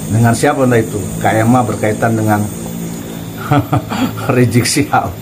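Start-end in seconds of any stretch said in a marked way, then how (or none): 2.5-4.3 soft chuckle before a name; mocking laugh. Real, not acted. Commentator on the leaked recording, amused chuckle. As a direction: chuckle to yourself mid-sentence.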